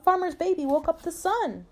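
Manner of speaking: high, delighted announcement; excited dog. A narrator's reading. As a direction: share big news as the storybook dog. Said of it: "said Dog".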